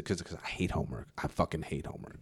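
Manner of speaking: a hushed teacher's confession; confiding teacher. Retold or imitated. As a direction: let him confess it under his breath. Teacher confides he hates homework.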